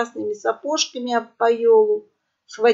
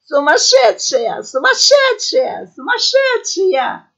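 very high, drawn-out squeals; laughing shrieks; 9 semitones higher, 5 dB louder, slower than usual. A narrator's reading. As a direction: squeal it helplessly in hilarity. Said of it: "при этом кликача". (визг от хохота)

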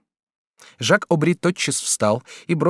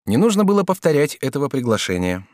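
ordinary delivery

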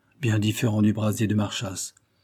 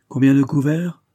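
higher, louder question; calling out; 5 semitones higher, 6 dB louder than usual. A narrator's reading. Marchas hails the returning narrator, calling out. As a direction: call it across the room.

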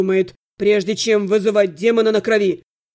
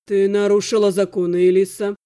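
icy, lowered voice; 5 semitones lower, 4 dB louder than usual. A narrator's reading. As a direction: pronounce judgement coldly on your sister. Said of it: "Голос Ирги был таким ледяным". (ледяным низким голосом)

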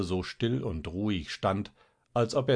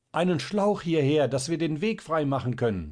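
high, loud call for the hose; shouting back; 7 semitones higher, 4 dB louder than usual. A narrator's reading. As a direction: yell back down the stairs. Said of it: "schrie Schierling zurück". (lauter Ruf nach hinten)

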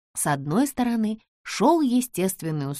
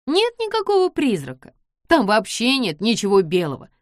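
confident tomboy; 4 semitones higher, 5 dB louder than usual. Acted. George dismisses the ghost.

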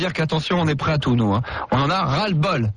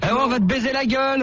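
higher, jeering taunt; mocking threat; 6 semitones higher than usual. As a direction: gloat at the victim. Canular, il nargue l'employé. (nargue la victime)

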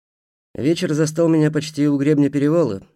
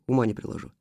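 plain reading voice

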